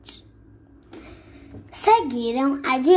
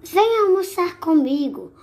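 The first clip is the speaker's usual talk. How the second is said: high, sugary fox invitation; sly fox voice; 8 semitones higher, 3 dB louder than usual. Acted. Fox tempts the mouse to lunch, high and sugary. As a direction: lure him in sweetly.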